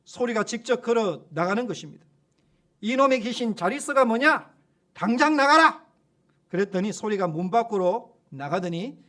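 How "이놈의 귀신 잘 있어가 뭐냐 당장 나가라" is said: higher and louder, building to a yell; loud rebuke. Retold or imitated. Pastor to demon saying goodbye, rising angry shout. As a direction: order it out at once.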